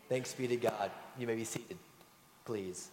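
ordinary delivery

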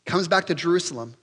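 normal talking voice

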